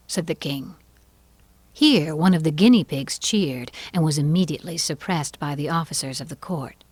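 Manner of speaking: ordinary delivery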